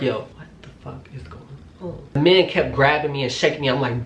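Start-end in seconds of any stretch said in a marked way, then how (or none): 0.0-1.4 very soft, quick aside in shock; hushed disbelief. Retold or imitated. Woman reacting to her keys being thrown, hushed disbelief. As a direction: drop to a hushed, stunned mutter.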